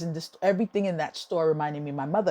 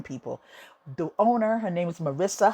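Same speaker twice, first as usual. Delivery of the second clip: normal talking voice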